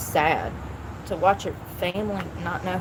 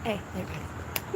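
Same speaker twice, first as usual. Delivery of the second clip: usual speaking voice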